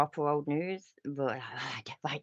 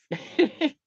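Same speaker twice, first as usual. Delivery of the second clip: high laugh; 12 semitones higher, 8 dB louder than usual. Real, not acted. Laughing at donor.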